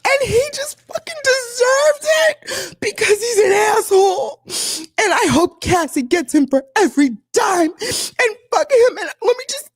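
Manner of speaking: very high, wailing, half-laughing sob; hammed-up high sobbing rant; mock sobbing friend. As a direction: imitate a friend crying and ranting at once. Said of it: "And she's like".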